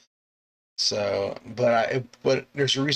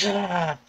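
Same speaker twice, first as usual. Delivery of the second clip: high mock scream of fright; acted startled cry; startled yelp; 9 semitones higher than usual. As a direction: yelp like a startled bus driver. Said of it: "a common reaction".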